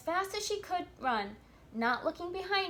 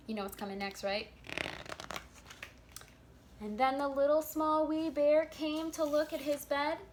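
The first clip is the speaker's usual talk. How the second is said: usual speaking voice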